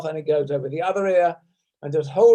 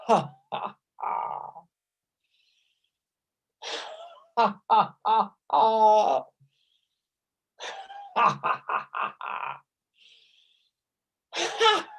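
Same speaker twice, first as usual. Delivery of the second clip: breathy rhythmic has, some fading to whisper; ha breaths; 4 semitones higher, 3 dB quieter, slower than usual. Shown as a demonstration. Teacher leads seven ha breaths in laughter yoga, breathy has. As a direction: exhale each ha on the twist. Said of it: "keep doing that until you get to seven ha's".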